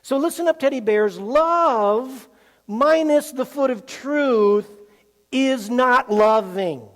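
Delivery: slow, loud, each word stressed; preaching emphasis. Real, not acted. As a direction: hammer the point home to the teddy bears. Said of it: "Did you hear that".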